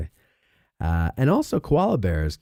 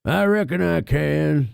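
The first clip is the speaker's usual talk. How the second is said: drawn-out, slightly higher hammy impression; father's movie quote; 4 semitones higher, slower than usual. Father looking down from the cupola, hammed-up drawn-out impression. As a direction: stretch it out like a film catchphrase. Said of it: "That's all he's saying".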